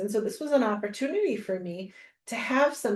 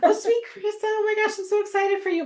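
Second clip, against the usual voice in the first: much higher sing-song imitation of the host; sweet host voice; 12 semitones higher than usual. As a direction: coo it like the host greeting a fan. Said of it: "that's what she called me".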